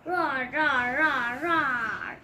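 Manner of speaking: loud playful dinosaur roars; kid's pretend dragon roaring; repeated roars. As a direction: roar again and again like a dragon. Biggest dino dragon roars.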